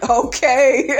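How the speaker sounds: bursts out laughing at the claim; real amused laughter round a dry okay. Real, not acted. Laughing at Marceau's toast claim.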